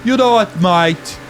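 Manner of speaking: a failed Brummie that slides toward Australian; a botched Birmingham accent with an Aussie drift; Brummie accent attempt. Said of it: "I can't do it".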